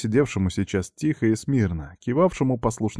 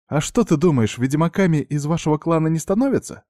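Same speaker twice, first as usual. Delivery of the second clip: high mocking smirk; 8 semitones higher than usual. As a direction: tease him with a smirk. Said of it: "усмехнулась женщина".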